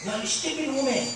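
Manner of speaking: high-pitched mock yell of an accusing teenager; imitated angry yelling. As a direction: hurl the accusation like a furious daughter. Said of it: "she started yelling at the mother".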